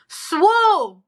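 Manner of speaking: "Swoo" high, loud and drawn into one syllable; exasperated correction. Shown as a demonstration. Driving home the one-syllable TikTok name, exasperated. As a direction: call out "Swoo" loudly on one high swoop.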